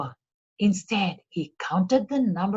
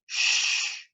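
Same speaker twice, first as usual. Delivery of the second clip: whispered hush; shushing whisper; 3 dB quieter than usual. A narrator's reading. Ali Baba hushes his housekeeper, whispering. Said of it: "said Ali Baba".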